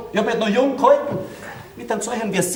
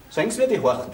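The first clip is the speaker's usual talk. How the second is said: ordinary delivery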